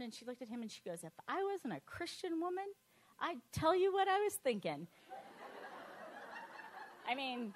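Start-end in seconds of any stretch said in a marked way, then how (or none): 1.0-4.9 higher mock aunt, half-laughing on the end; aunt's barbed reply to uncle, voiced higher; mimicking her aunt. Aunt voiced in retold argument. 5.1-7.0 high breathy burst of laughter; amused unvoiced laugh; real laugh. Real, not acted. Laugh after quote.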